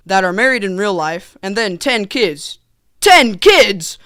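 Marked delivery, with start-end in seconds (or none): 3.0-4.0 loud, raised double take; hammed-up astonishment; mock disbelief. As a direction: blurt it out, appalled at the number. Repeats it in comic disbelief.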